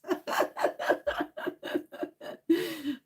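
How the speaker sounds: long, airy laugh that trails off; quiet giggle; breathy laugh. Real, not acted.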